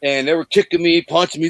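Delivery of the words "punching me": ordinary delivery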